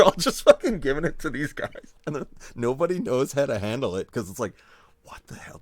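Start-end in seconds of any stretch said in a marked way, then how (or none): 5.0-5.6 whisper. Retold or imitated. Others baffled, whispered quote.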